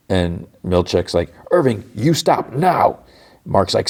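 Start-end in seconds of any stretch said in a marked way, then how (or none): 1.5-3.0 high, slow imitated order; acted-out urgent command; stern command. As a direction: retell the boss ordering him, word by word. Boss orders attacker to stop.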